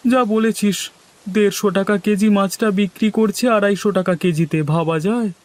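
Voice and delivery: high-pitched incredulous agreement; indignant villager. Acted. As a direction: pitch it high, scandalized by the price.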